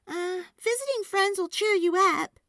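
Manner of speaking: put-on cheerful little girl. A narrator's reading. Girl cheering up her squash, high and loud. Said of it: "Sophie said".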